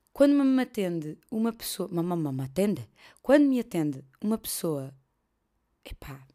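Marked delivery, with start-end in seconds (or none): none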